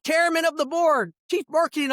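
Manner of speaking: high and loud brag; boastful shout. Real, not acted. Listing his own titles to Barry, loud boast. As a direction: crow the title out.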